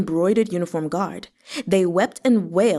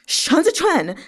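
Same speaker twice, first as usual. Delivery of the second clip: angry shout; 8 semitones higher, 3 dB louder than usual. A narrator's reading. Shouting after the captor.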